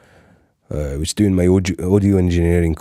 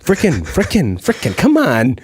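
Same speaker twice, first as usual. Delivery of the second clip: higher New Yorker exasperation; hammed-up Brooklyn "frickin'"; New York Italian accent; 6 semitones higher than usual. Shown as a demonstration. New Yorker accent shown to the podcast host. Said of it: "do this impression".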